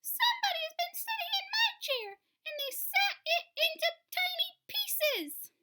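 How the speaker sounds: very high, loud squeal with a laugh in it; baby bear squealing over his broken chair; squeaky squealing cub. A narrator's reading. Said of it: "In a voice somewhere between a squeak and a squeal".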